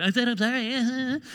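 mock a grudging apology in an altered voice; insincere apology. Preacher mimicking someone who has to insist, mock defensive. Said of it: "when you have to say it like that".

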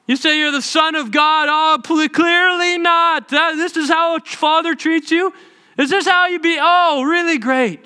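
high, loud sarcastic sneer; mock his faith in the father; mocking taunt.

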